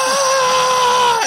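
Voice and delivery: huge, high shouted roar; explosive mock shout of the soundtrack; imitated blast roar. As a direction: bellow it like music cranked to full volume. Imitating the blasting song cue.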